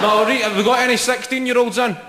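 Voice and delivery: high, projected crowd call; shouting to the crowd. Real, not acted. Comic asking the audience for sixteen-year-olds, shouting out. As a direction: call it out to the back of the room. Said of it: "when you hear yourself shouting".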